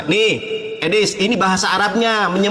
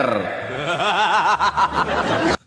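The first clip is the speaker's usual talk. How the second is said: mocking laugh. Real, not acted. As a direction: let the scornful laugh roll out. Laughs after taunting opponent.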